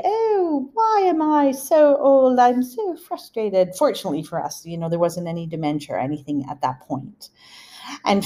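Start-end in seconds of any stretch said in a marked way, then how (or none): 0.0-3.8 high, slow pretend moan; put-on wail of self-pity, starting high and easing down; mock lament. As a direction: bemoan it slowly in a high mock whine. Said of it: "she didn't say".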